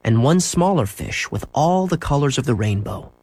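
ordinary delivery

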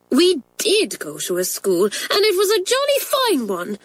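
high-pitched schoolgirl indignation; indignant cry. A narrator's reading. Pat defending her old school to teachers, indignant. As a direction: protest hotly, climbing very high. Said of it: "cried Pat indignantly".

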